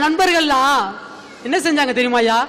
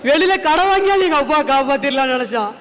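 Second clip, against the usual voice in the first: normal talking voice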